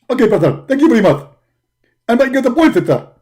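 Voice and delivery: loud, hearty signing off; Zakir Naik. Acted. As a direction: boom the thanks loudly. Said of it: "Doctor Zakir Naik".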